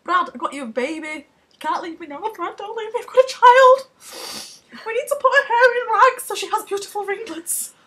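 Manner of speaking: high, loud whiny pleading, rising; mock clingy Tom Cruise. Tom Cruise begging Brad not to leave, whiny high plea. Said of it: "he's like".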